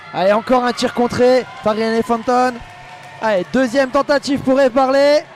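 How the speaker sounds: building up to a loud goal call; excited sports commentary. Real, not acted.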